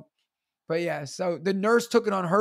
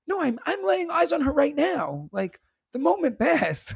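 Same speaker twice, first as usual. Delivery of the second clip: exasperated; 10 semitones higher than usual. Retold or imitated. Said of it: "And I was like".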